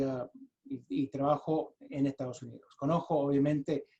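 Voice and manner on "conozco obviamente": normal talking voice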